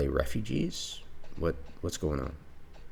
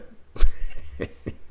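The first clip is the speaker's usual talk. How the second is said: disbelieving laugh; 13 dB louder than usual. Real, not acted. Laughs after the ship leaves.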